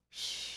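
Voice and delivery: hushed breathy snore sound; pretend snore. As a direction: fake a sleepy snore. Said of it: "pretend to snore".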